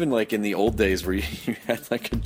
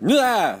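startled yelp; 12 semitones higher, 5 dB louder than usual. Retold or imitated. Startled by jumping zombie.

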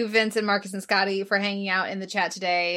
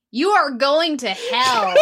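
slow, high, loud Michael impression; Michael accent; 7 semitones higher, 6 dB louder, much slower than usual. Shown as a demonstration. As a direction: draw it out in a hammed-up Michael voice. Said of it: "a Michael accent and then a Lucifer accent".

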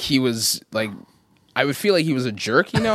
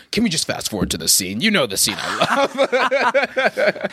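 imitating Richard; 6 semitones higher than usual. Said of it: "he's like".

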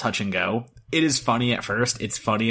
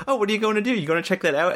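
higher-pitched imitation of a boy; lightly voiced boy chatting to his dog; 9 semitones higher, faster than usual. Boy asks his wandering dog, lightly imitated boy voice.

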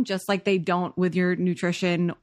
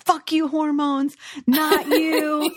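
mock yell; 7 semitones higher, slower than usual. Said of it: "when he's yelling".

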